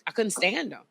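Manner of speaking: ordinary talk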